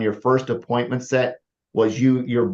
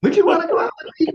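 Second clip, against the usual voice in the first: much higher, hesitant asking; high doubtful tone; 3 dB louder, faster than usual. Shown as a demonstration. Coach shows a doubtful guy asking for a date. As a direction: ask her out full of doubt. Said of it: "I'll use this in a dating scenario".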